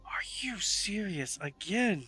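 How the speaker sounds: breath-heavy, disbelieving drow; exasperated drow. Acted. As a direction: half-whisper your disbelief as the drow. Drow reacts to losing.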